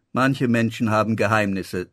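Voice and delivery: usual speaking voice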